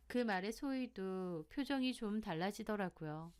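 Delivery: ordinary delivery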